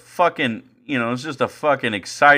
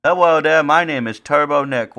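higher, louder dopey introduction; Turbo Nick voice; 5 semitones higher, 4 dB louder than usual. Acted. Host introduces himself in character, lightly goofy. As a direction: introduce yourself like a cheerful simpleton. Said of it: "It's just a voice".

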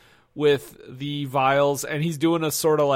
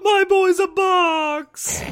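high, slow, loud hammed-up cry; drawn-out melodramatic howl; mock anguished wail; 5 dB louder, much slower than usual. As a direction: wail it like an overacting bereaved parent. Quoted movie wail as joke.

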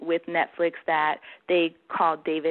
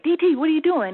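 excited greeting; 9 semitones higher, 6 dB louder, faster than usual. Retold or imitated. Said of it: "he's like".

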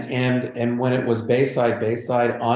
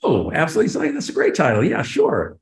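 higher, overly eager agreement; mock enthusiasm; 7 semitones higher than usual. Writer humoring the execs, with hammed-up fake delight. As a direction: gush over the title to keep the job.

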